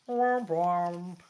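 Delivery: mock "womp womp" horn; sad trombone imitation. Reader capping the story's closing joke, mock trombone.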